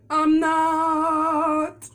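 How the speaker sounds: loud teasing declaration; playful loud. Real, not acted. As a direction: punch it out playfully. Teasing about saving the piece.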